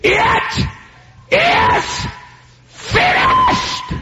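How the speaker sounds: soaring high shout, each word apart; loud scream. Retold or imitated. Jesus on the cross screams out, high and loud. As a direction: cry it out at the top of the voice. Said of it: "He screamed with a loud voice".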